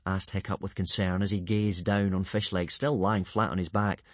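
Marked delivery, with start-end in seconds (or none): none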